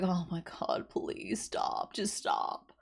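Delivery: whispered, pleading to stop; hushed mock plea voicing the girl in court. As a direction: whisper it like begging them to stop. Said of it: "this girl's just like".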